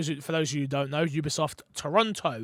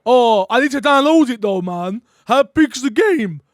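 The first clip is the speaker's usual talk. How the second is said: loud, high mock complaint; hammed-up reluctant gamer; questionable impression; 5 semitones higher, 9 dB louder than usual. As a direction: whine loudly like a put-upon mate. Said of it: "These impressions are questionable".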